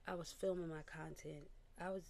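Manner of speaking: ordinary delivery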